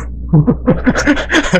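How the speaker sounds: rising laugh. Real, not acted. Laughs as float rises.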